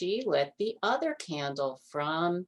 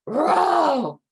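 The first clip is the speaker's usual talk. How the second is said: high loud roar; bear roar; 10 semitones higher, 9 dB louder than usual. Acted. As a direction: let out a big bear roar. Bear sound.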